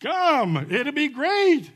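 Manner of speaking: high, loud pleading call; Jesus voiced, urgently inviting. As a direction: beg them to come, arms open. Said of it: "he's pleading".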